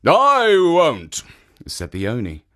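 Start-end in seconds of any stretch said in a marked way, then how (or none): 0.1-1.2 ogre voice. Acted. Ogre answers.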